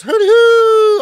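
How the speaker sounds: hollered high greeting; hooty hoo call. Pretend. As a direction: whoop it out like a cheer. Reading out the chat's greeting.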